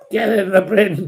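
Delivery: loud taunt. Real, not acted. Throwing a troll out of the chat, loud and jeering. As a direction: jeer the troll off the stage.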